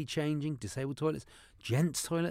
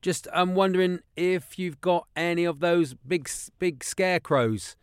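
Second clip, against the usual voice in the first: put-on caller voice; 5 semitones higher, 6 dB louder than usual. Prank caller voice on phone.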